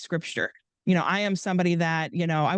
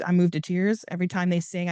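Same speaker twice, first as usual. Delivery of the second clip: ordinary delivery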